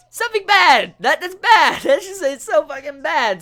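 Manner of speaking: high, loud cry of disgust; loud disgusted outburst. Real, not acted. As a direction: yell it out, grossed out. Disgusted by vomit scene.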